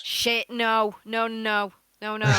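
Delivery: loud panicked no; alarmed shout. Real, not acted. Realises spell would hit ally.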